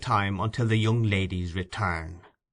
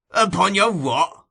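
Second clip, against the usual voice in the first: slow, louder scornful retort; disgusted growl; 9 semitones higher, 6 dB louder, slower than usual. A narrator's reading. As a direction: spit the words back in disgust. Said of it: "growled Sikes with excessive disgust".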